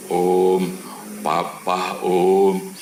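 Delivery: drawn-out mock wail, loud on the calls; mildly acted sobbing plea; imitated tearful call. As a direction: imitate a crying child calling out. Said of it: "anaknya sambil nangis bilang gini".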